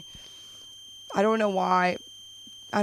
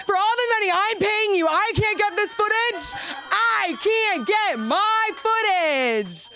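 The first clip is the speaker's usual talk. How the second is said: high, louder whine, stretched at the end; mock whine; 12 semitones higher, 3 dB louder, slower than usual. Comedian whining at her therapist about the footage, high and stretched. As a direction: whine like a spoiled client.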